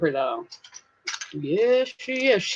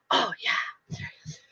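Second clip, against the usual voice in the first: usual speaking voice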